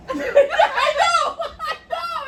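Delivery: high-pitched laughing I know. Real, not acted. Friend cracking up while agreeing over the comforter, laughing hard. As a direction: crack up helplessly as you agree.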